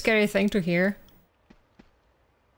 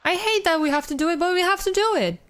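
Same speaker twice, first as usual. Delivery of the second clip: higher, quicker exasperated outburst; exasperated exclaim; 8 semitones higher, faster than usual. Real, not acted. Reluctant exclaimed complaint.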